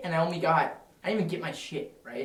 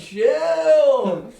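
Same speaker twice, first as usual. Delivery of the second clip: higher, loud "Chill"; mock shout; 9 semitones higher, 10 dB louder than usual. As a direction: snap it out to calm them down. Imitating himself telling friends off.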